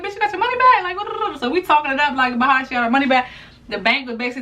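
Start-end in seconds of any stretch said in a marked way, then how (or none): 0.0-1.4 surprised. Retold or imitated. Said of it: "I called her like".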